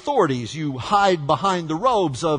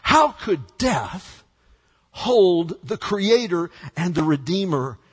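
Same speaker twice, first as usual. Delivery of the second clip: ordinary delivery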